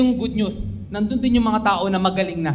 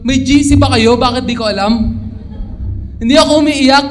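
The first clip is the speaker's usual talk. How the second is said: loud, higher mock outrage; indignant retort; 4 semitones higher, 8 dB louder than usual. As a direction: play up the outrage. Retelling his indignant reply.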